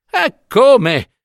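high, short startled question; startled exclamation. A narrator's reading. Old woman reacting to her friend's shock, exclaiming. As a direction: exclaim it, voice jumping up. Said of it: "Esclamò la donna".

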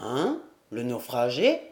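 put-on a touch of a low, questioning old-timer; lightly voiced uncle. A narrator's reading. Uncle learning of the castaway, low mild puzzlement. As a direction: ask it low, with a rising Hein. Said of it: "fit Corentin".

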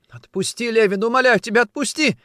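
high, loud, frantic begging; desperate pleading. A narrator's reading. Woman fallen at his feet begging release, desperate. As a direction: implore at the top of the voice.

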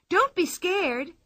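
cried out. Acted. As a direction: reassure the climbing policemen, playfully. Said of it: "cried Pippi".

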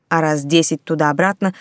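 usual speaking voice

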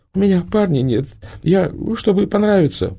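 put-on high, halting girlish voice; stammering girl. A narrator's reading. The girl stammers her reason to Prima, high and halting. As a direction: stumble over the words.

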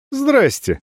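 high, insolent hello; rude greeting. A narrator's reading. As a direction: toss it off rudely. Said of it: "хамски поприветствовал".

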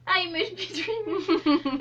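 put-on slow English-accented sigh; drawn-out English accent.

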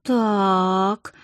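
one long, stretched word; drawn-out. A narrator's reading. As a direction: draw the vowel right out. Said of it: "протяжно сказал мой муж".

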